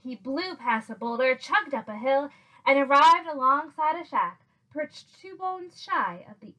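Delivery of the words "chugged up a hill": ordinary delivery, nothing marked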